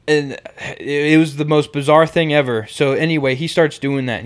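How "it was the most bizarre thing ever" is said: usual speaking voice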